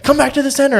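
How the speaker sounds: very high imitated instruction; imitating a floor manager's high-voiced order; camera woman. Imitating camera woman calling him back.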